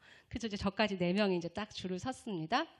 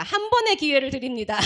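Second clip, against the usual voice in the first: defiant ultimatum; 2 semitones higher, 10 dB louder than usual. Retold or imitated. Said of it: "이러면서 이제 딱 폈어요".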